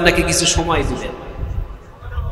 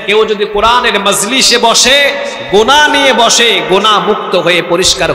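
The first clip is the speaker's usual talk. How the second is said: rising, louder preacher's call; preaching; 5 semitones higher, 4 dB louder than usual. Acted. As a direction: build the point with fervor. Preacher building up.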